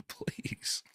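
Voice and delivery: breathy laughing whisper; whispered please. Real, not acted. Host whispering please after reading Sarah's reply.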